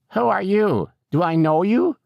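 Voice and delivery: put-on high-pitched, querulous old lady; high, wary character voice; suspicious old woman. A narrator's reading. Suspicious old resident confronts visitor.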